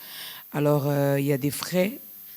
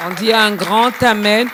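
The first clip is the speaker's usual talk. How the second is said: loud, raised-pitch call for a response; rallying call; 5 semitones higher, 9 dB louder than usual. Real, not acted. Host urging everyone to a big amen, loud rallying call. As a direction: call out to rouse the congregation.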